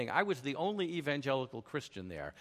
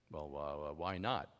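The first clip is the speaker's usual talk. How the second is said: much lower and softer, a tired, put-upon moderator; weary moderator; 10 semitones lower, 7 dB quieter, slower than usual. Retold or imitated. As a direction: sigh out the question like a tired host.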